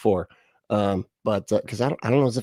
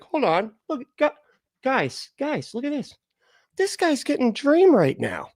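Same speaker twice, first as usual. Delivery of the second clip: high, excited demon voice, slowing at the end; a gleeful little demon calling his friends over; scheming demons; 14 semitones higher than usual. Pretend. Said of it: "a couple demons be like".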